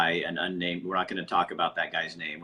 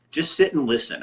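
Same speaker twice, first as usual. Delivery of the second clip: a firm, louder and higher rebuke to commenters; stern order; 5 semitones higher, 6 dB louder than usual. Real, not acted. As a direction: tell them off. Scolds arguing commenters.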